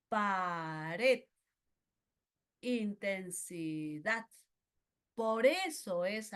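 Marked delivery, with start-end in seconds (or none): 0.1-1.3 "Pared" drawn out, rising to RED; stress on final syllable. Shown as a demonstration. Teacher demonstrating an aguda word, "Pared" stretched with stress on RED. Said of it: "esa elevación de la voz está al final". 2.6-4.3 "intensidad" slowly syllabled, in-ten-si-DAD; stress on final syllable. Shown as a demonstration. Teacher demonstrating an aguda word, slow "intensidad" stressed on DAD. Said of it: "esa elevación de la voz está al final".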